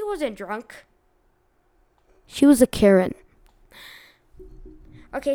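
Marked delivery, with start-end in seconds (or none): 2.3-3.1 loud. Real, not acted. Punchline about the Karen.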